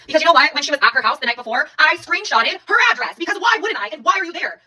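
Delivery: high-pitched, challenging delivery; indignant self-justification. Real, not acted. Indignant self-justification about following partner.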